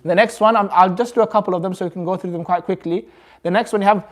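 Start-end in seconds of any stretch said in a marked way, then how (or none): none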